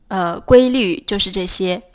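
ordinary talk

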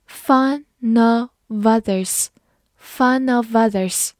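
"Fun of others" slow, words linked together; slow linked demo; linking words. Shown as a demonstration. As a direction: join fun-of-others smoothly. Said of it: "后三个单词可能出现连读".

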